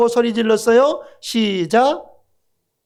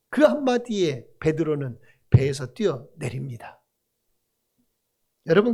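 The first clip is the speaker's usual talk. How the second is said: ordinary talk, nothing marked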